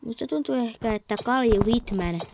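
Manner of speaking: ordinary talk, nothing marked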